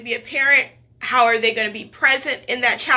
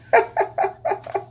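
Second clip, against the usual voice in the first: laugh; 9 semitones higher than usual. Real, not acted. Laughing at herself through tears.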